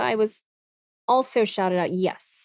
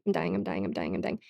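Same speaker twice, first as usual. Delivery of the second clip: much lower, much softer fade; fading mock dying; 11 dB quieter, faster than usual. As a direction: let it die away. Mock dying after laugh.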